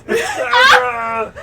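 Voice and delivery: high, strained Ray Romano shout. Shown as a demonstration. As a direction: squeeze out the name in a strangled cry. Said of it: "Ray Romano voice".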